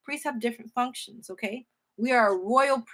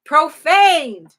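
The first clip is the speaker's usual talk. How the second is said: crying out in hurt; 2 semitones higher, 9 dB louder, slower than usual. Acted. God lamenting his profanation to his people, in a slow cry of hurt. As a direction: let the pain break out on the final word. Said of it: "It was just like someone crying".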